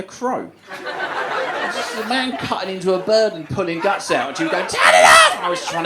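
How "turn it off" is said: very high, loud shriek; screamed demand. Retold or imitated. Girlfriend horrified by a taxidermy video, high loud shriek. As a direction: shriek it in mock horror.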